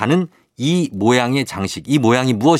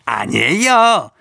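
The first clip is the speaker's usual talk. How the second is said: Santa voice; 9 semitones higher than usual. Pretend. Santa voice answers quiz.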